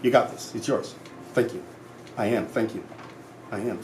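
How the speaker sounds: quietly reassuring mantra; gentle affirmation. Shown as a demonstration. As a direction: say it softly to yourself like a promise. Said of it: "Like for me, it's".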